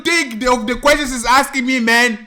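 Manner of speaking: loud, high protest; acted exasperated plea. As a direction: beg loudly for a break. Child pleads during the beating.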